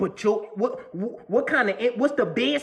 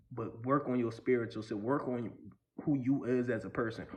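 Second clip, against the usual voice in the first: much lower, softer, earnest; quiet advice; 7 semitones lower, 6 dB quieter than usual. Real, not acted. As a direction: bring it down to a low earnest talk.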